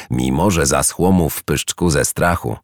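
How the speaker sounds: plain reading voice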